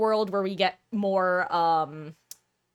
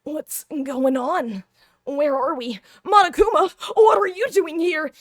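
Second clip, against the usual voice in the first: higher, louder startled hero; anime protagonist voice; 8 semitones higher, 6 dB louder than usual. Acted. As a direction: shout at Monokuma in surprise. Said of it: "this is my generic anime protag voice".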